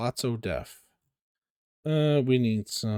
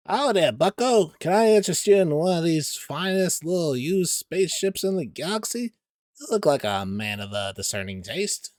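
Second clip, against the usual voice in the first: salesman voice; 7 semitones higher, 4 dB louder than usual. Acted. Voicing Tiny the salesman.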